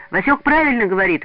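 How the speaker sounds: calling out. A narrator's reading. Одинцов backs his friend among noisy pioneers, calling out. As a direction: call it out over the noisy group. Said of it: "выкрикнул Одинцов".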